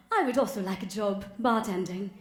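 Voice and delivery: sudden put-on British voice; mock-English job request; fake British accent. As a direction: blurt it in a badly faked British accent. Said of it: "interview in my regular voice".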